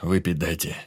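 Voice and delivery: rasped demand; hoarse demand. A narrator's reading. Wounded Pyotr demanding a drink, hoarse. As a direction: croak out the demand. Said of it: "потребовал Пётр хриплым голосом".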